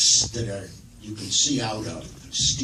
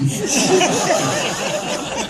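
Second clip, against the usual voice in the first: a very high, rising laugh; high burst of laughter; 4 dB louder than usual. Real, not acted. Laughing at his own wandering English accent. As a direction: break up laughing mid-sentence.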